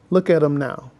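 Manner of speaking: ordinary talk, nothing marked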